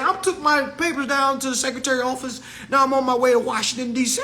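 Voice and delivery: high mocking voice of a clueless believer; mock high voice of someone who thinks they solved it; mocking people. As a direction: pitch it way up to mock them. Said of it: "People be saying".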